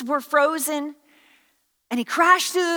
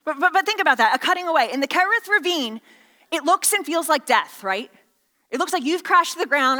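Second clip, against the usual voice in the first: ordinary delivery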